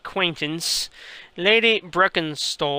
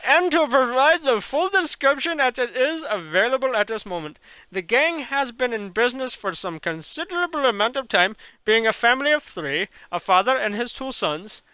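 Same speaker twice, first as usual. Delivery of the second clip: put-on Indian accent; 3 semitones higher than usual. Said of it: "talk this long with an Indian accent".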